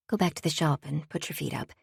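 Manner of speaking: softly whispered advice. Acted. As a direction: murmur it close to his ear. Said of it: "Nora whispered to him".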